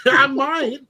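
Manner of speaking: high, loud laughing echo; mock your own answer through laughter; laughing self-mockery. Echoing the friend's imitation of his answer, high laughing self-mockery.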